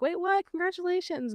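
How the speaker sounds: imitated amazed coworker, high pitch; surprised congratulations. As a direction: react high, thrilled and surprised. Another coworker hears the news.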